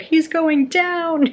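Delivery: high, loud, slow gleeful call; mock announcer call. Riddick watching a knee to the groin, gleeful slow call-out. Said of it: "Riddick's like".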